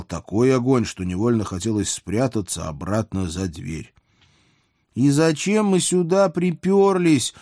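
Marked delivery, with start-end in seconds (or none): 4.9-7.3 high, drawn-out call over roaring flames; shouting over noise. A narrator's reading. Princess questions the narrator over the flames, shouting to be heard. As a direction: raise the voice to carry over the fire. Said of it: "перекрикивая пламя".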